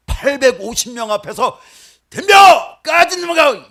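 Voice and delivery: loud, high battle taunt at the enemy; defiant shout. Acted. Elijah daring 850 prophets, a loud high defiant shout. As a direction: bellow the challenge, chest out.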